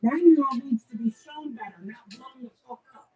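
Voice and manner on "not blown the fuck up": normal talking voice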